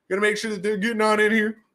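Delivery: high-pitched put-on voice; silly high voice. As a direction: repeat it playfully, pitched right up. Repeats line in silly voice.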